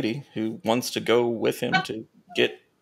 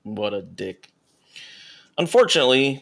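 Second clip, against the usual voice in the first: normal talking voice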